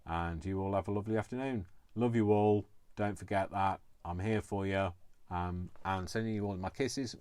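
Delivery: usual speaking voice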